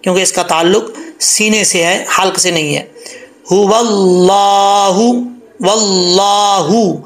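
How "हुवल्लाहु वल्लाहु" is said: slow chest-voiced हुवल्लाहु; heavy हा, drawn out. Shown as a demonstration. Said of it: "भारी आवाज़ में पढ़ा जाएगा".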